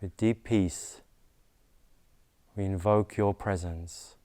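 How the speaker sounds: measured devotional invocation with long pauses; prayer invocation. Real, not acted. Leads spoken invocation, repeat after me.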